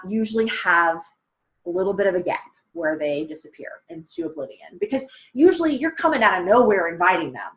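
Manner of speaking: usual speaking voice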